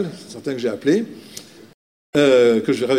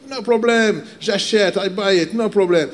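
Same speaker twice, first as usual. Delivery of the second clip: higher mock American accent, keen to buy; the American buyer again in his accent, higher; imitated American accent, eager; 5 semitones higher than usual. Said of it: "avec son accent américain".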